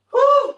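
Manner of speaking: loud, high whew of relief. Real, not acted. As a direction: blow out a big, high breath after effort. Exhale after set of exercises.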